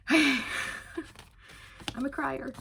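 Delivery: slow, teary and choked up; emotional. Real, not acted. Thanking viewers while fighting back happy tears. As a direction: let the tears sit in the words. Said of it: "I'm getting emotional again".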